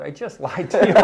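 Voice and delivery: loud, high, breaking into laughter; laughing confession. Real, not acted. Storyteller laughing at his old lie to the monsignor.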